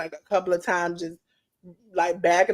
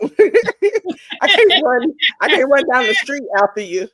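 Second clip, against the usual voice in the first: laughing through the words; 4 semitones higher, 7 dB louder, slower than usual. Real, not acted. Laughing as she jokes about not running. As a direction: laugh it out, slowed right down.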